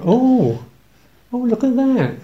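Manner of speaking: nurse's delighted look. Retold or imitated. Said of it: "she's saying".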